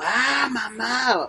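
high, long-drawn-out mock cry for mom; imitated silent scream. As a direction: act out a scream trapped in your head. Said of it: "en mi mente gritaba así como".

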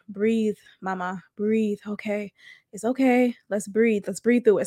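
calm yourself down gently, under your voice; soothing self-talk. Retold or imitated. Talking to herself in third person through tears, soft soothing. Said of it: "I say to myself".

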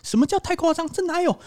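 put-on high, preachy old-man voice lecturing the newcomer; mocking lecturing old man. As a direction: pitch it way up like a condescending uncle. Imitating the lecturing old neighbour.

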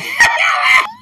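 sudden high burst of laughter; loud laugh. Real, not acted. Big laugh at stereotype.

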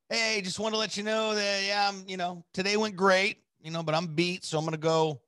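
high, sing-song message voice; acted upbeat message. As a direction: put on a high, chipper check-in. Acting out his message to her.

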